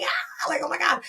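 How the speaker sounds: high-pitched retold squeal of excitement; mock screaming. Friend reacting to teammate making veteran, mock screaming. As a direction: imitate shrieking at great news, held back. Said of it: "I was, like, screaming".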